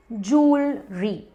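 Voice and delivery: जूलरी drawn out as "jool... ree"; slow correct pronunciation. Shown as a demonstration. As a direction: break जूलरी into two slow syllables. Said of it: "वो होता है जूलरी". (जूलरी को धीरे "जूल-री" बोलें)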